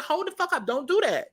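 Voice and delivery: imitating a high warning voice; high retold quote. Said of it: "She said".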